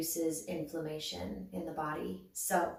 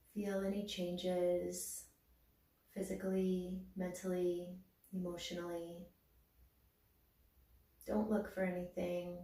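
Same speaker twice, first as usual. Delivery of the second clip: guided meditation; 6 dB quieter than usual. Acted. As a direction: guide them gently inward, unhurried. Leads a body-awareness meditation.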